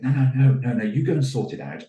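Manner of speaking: quick loud brush-off, acting the head teacher; brusque refusal. Head dismisses an interrupting teacher.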